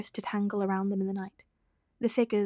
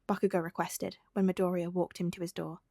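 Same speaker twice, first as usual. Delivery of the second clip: plain reading voice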